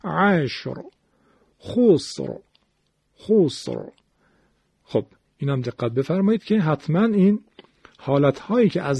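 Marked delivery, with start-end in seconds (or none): 0.0-3.8 عشر، خسر with a thick, full final ر; heavy R. Shown as a demonstration. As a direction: recite عشر، خسر one by one, ending on emphatic ر. (عشر، خسر با رای ساکن و درشت)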